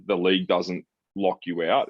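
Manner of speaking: normal talking voice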